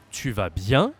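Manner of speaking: loud, clear French question climbing at "bien"; rising question. Shown as a demonstration. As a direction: voice lifts sharply on "bien". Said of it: "And raise your voice".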